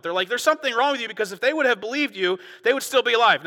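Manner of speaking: preaching at full force. Acted. Preacher rousing his congregation, full-throated and loud. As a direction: build the outrage to the back row.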